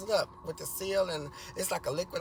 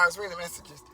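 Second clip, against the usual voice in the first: ordinary talk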